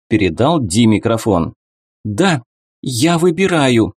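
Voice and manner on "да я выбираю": shaky, raised trembling voice. A narrator's reading. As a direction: let the voice tremble with nerves. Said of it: "дрожащим голосом произнесла Ди".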